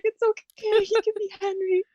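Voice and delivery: high, choked voice, crying through the words; real tears breaking into the retold reply; tearful, voice breaking. Real, not acted. A mother retelling her husband's words about the baby's name, through real tears.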